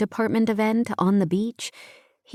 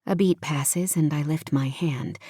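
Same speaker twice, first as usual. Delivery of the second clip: plain reading voice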